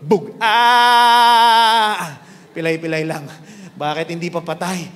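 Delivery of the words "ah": louder, brief cry of a short fall; short pained yelp. Shown as a demonstration. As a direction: yelp like falling from the second floor. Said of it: "ganito yung tunog".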